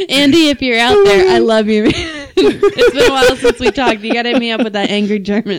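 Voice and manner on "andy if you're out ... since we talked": laughing through a louder shout-out. Real, not acted. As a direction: laugh while calling out to a friend. Laughing shout-out to an old friend.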